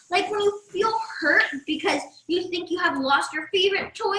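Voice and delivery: usual speaking voice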